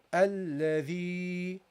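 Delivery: Alladhi drawn out on a long, level EE; prolonged final vowel. Shown as a demonstration. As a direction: hold the end of Alladhi, slowly. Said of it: "you will prolong it".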